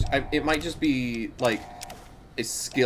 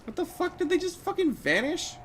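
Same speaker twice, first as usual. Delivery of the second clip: surprised; 5 dB quieter than usual. Real, not acted. Shocked at vanishing item.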